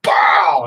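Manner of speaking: loud punched-out "bow"; imitated impact bang. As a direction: burst out the sound of the hit. Imitates drill hitting his face.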